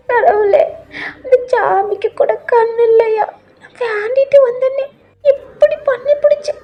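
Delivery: very high, drawn-out tearful wail; high keening granny prayer; grandmother's lament. Acted. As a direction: lament to God as a crying old woman. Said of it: "மனசுக்குள்ளேயே புலம்பிட்டு".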